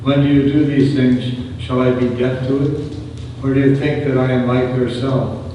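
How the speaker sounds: ordinary delivery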